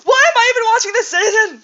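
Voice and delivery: loud, high-pitched wail of frustration; exasperated outburst. Acted. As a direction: burst out yelling in despair. Outburst at the let-down.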